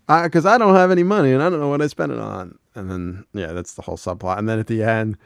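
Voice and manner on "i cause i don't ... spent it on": high, loud and fast; Bill's panic. Retold or imitated. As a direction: blurt it all out. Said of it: "And he goes".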